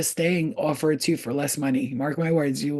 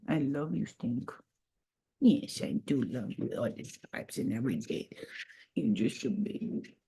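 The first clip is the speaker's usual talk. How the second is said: soft cooing; 6 semitones lower, 9 dB quieter than usual. Real, not acted. Telling her pet she loves it, soft murmur.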